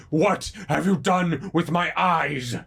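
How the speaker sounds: roaring, anguished monster demanding answers; rumbling monster voice. Acted. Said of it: "the same voice you just heard".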